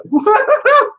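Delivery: high, loud whooping laugh; giddy shriek of laughter; overjoyed laugh. Real, not acted. Overjoyed at far-flung viewers.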